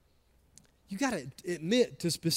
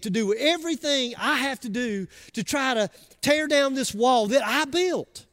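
building preacher's cadence, higher; preaching; 5 semitones higher than usual. Acted. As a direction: preach it, pressing each line. Preaching with rising force.

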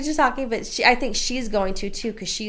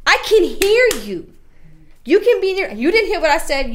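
scolding shout; 9 semitones higher, 7 dB louder than usual. Retold or imitated. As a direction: scold like a fed-up mom. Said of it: "and I said".

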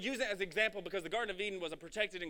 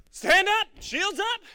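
much higher, loud battle cry; rallying shout; 13 semitones higher, 15 dB louder, much slower than usual. Retold or imitated. Fellow believers calling to raise shields, shouting. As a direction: yell it to rally the troops.

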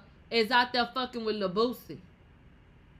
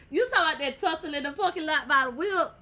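laughing; 6 semitones higher, 6 dB louder than usual. Real, not acted. Laughing at the rumour.

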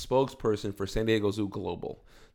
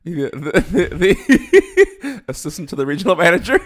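louder, higher, words broken by laughter; laughing; 9 semitones higher, 12 dB louder than usual. Real, not acted. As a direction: giggle through the line. Laughing through Office quote.

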